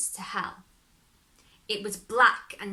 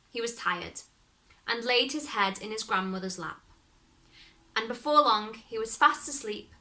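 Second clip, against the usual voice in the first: plain reading voice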